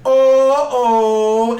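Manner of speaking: long, high, loud "oh"; mock dread wail. You see her creepy smile, long high mock-dread "oh". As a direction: draw out a horrified viewer's wail.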